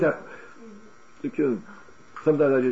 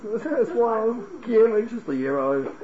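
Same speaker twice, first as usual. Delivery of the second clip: high, amused dismissal; no-big-deal reading; 8 semitones higher, much slower than usual. Shown as a demonstration. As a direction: wave it away as a trifle. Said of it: "what's the big deal?".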